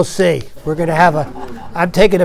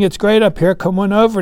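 ordinary talk, nothing marked

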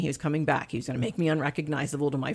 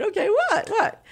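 high-pitched, laughing, startled what; wife caught off guard by her husband; nervous laughing surprise; 6 dB louder than usual. Real, not acted. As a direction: laugh it off in sudden alarm. Husband says God told him something.

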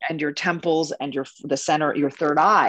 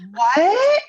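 high, loud shocked yelp; startled squeak of disbelief; shocked what; 13 semitones higher, 6 dB louder than usual. Retold or imitated. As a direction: blurt it out in disbelief. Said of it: "And we're like".

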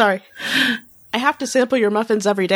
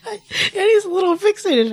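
real giggle breaking through the doctor's line; laughing; 10 semitones higher than usual. Real, not acted. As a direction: she can barely hold the read together. Laughs while reading the doctor.

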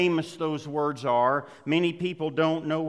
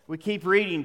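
usual speaking voice

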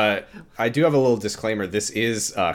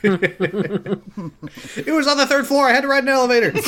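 giggly bragging voice; 13 semitones higher than usual. Acted. Continues the party brag.

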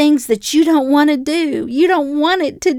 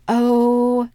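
spirit man cringing. Retold or imitated. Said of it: "my spirit man just kinda cringed".

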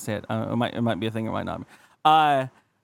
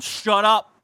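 higher, louder curt shut up; curt snap; 6 semitones higher, 3 dB louder than usual. Retold or imitated. Old husband to his wife, mock harsh snap.

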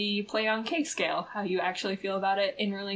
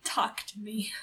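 hushed, whispered plea; playful whisper; 5 dB quieter than usual. Pretend. Host inviting listeners with feelings to write, playful whisper.

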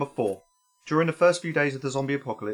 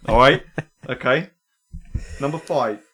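ordinary delivery

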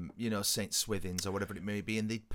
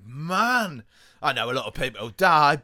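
mock smug survivor; 3 semitones higher, 10 dB louder than usual. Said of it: "I just remember thinking".

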